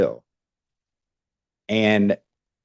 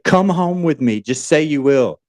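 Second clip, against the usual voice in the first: higher, pleading insistence; passionate plea; 6 semitones higher than usual. Retold or imitated. On the train, she urges the stranger with passion. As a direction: beg him like you mean it. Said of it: "she starts getting more passionate about it".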